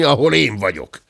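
plain reading voice

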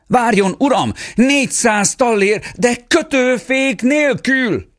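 "de kötőfék nélkül" called out high and slow; desperate call. Acted. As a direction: shout it after the departing rider, stretching "nélkül". Father calls after the rider. (kétségbeesett, magas, elnyújtott kiáltás)

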